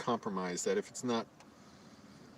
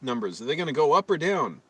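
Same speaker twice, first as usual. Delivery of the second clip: challenging; 4 semitones higher, 6 dB louder than usual. Retold or imitated. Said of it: "I said".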